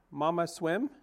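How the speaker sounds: high, small chick voice asking; pleading gosling. Acted. Gosling asking his robot mother to swim, hopeful. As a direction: ask it like a hopeful baby bird.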